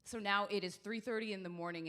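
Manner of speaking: usual speaking voice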